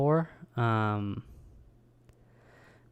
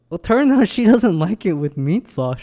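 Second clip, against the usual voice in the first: louder and higher, bursting out; loud punchline; 6 semitones higher, 8 dB louder than usual. Real, not acted. Telling viewers the twist, louder and higher. Punchline of the spaghetti test story.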